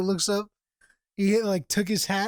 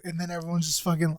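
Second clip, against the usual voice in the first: ordinary talk, nothing marked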